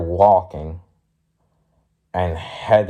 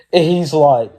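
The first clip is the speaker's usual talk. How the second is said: usual speaking voice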